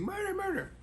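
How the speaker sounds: the neighbour shrieking as the trooper arrives, played as a high squeak; mock screaming woman. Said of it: "the woman screaming".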